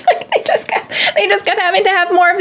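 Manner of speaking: high-pitched laugh spilling into words. Real, not acted. Viewer laughing in disbelief at the endless landings. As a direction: laugh it out, then squeak the words.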